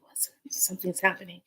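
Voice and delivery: soft, hushed realisation; acted wondering whisper. Grandmother smiles at the newcomer, acted hushed whisper. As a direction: whisper it as a dawning thought.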